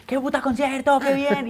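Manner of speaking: high, loud victory yell; post-show shout. Retold or imitated. Singer yelling after the concert, loud and high. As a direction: bellow it like you just came off stage. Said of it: "estar gritando".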